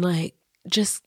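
soft, breathy and fond; tender whisper. Real, not acted. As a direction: whisper it with love. Tender praise of Jude.

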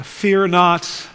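ordinary talk, nothing marked

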